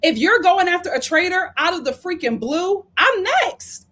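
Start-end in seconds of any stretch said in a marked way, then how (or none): 3.0-3.9 high, louder alarmed aside; mock panic, pitched up; panicked thought. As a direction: voice her sudden fear. Voicing Candace's inner thought.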